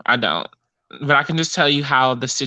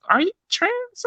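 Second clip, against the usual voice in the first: mock shocked gentleman asking; surprised high question. Man voiced in retold story.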